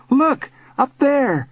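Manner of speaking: high, drawn-out alarm; urgent calling out. A narrator's reading. Boy pinned down spots flyer overhead, urgent. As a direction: point it out urgently. Boy points out flying figure.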